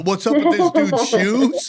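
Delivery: mock roast line quoted a second time. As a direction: sneer it out like a lazy roaster. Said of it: "They go a little deeper".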